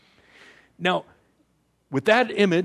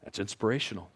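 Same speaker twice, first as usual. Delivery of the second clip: dry, quiet sarcastic aside; deadpan as if the crowd is unimpressed; mock sarcasm; 5 semitones lower, 9 dB quieter, faster than usual. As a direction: audience voicing a flat, softer put-down. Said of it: "I know what you're thinking".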